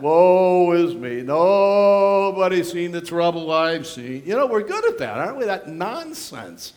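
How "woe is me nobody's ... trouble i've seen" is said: slow, louder, whiny woe-is-me; drawn-out mock lament; mock self-pity. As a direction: ham up a self-pitying moan. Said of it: "Self-pity".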